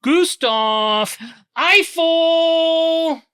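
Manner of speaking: high, drawn-out dramatic reveal; grand announcement. Acted. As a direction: announce him like a showman. Grand introduction of new figure.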